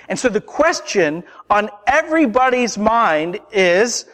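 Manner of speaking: ordinary talk, nothing marked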